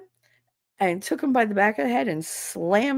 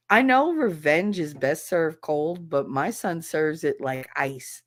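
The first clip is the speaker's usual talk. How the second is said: usual speaking voice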